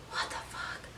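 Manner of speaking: hushed, barely-there whisper; stunned whisper. Retold or imitated. Teller facing three girls readying to fight, whispering stunned. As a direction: whisper it in disbelief to yourself.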